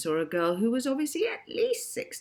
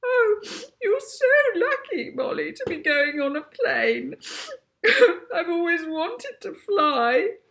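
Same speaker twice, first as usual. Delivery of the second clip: very high, loud tearful gush; teary high voice; 13 semitones higher, 8 dB louder than usual. A narrator's reading. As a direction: wail happily through tears. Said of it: "started to sniff again".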